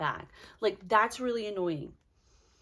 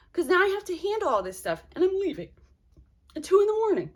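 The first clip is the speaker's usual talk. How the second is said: high, louder exasperated venting; real annoyance, higher and louder; frustrated; 6 semitones higher, 5 dB louder than usual. Real, not acted. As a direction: vent the frustration, pitched way up. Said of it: "I'm, I'm frustrated".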